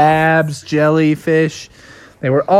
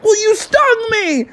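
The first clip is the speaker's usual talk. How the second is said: indignant retort. Acted. Bubby retorts to scorpion.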